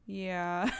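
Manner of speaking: a light, child-like mock of the little girl's yes; a hint of the little daughter, rising; daughter's voice. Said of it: "And she was like".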